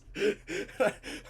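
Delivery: high laugh. Real, not acted. Laughter after quote.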